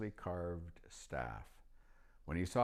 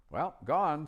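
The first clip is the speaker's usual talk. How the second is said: put-on higher, louder old wizard voice; old magician; 11 semitones higher, 9 dB louder than usual. A narrator's reading. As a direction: pitch up into the chatty old magician. Narrator voicing old magician.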